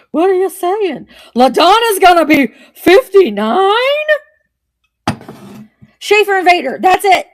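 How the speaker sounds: loud excited squeal; excited shout. Real, not acted. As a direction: blurt it out in delight. Excited reaction to chat.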